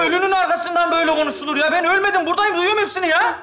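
high, agitated outburst; indignant protest. Acted. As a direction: protest that you are alive and hearing it all. Living man protests being mourned.

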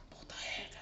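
breathy whispered swear under breath; whispered curse. Real, not acted. Muttered curse mid ghost story.

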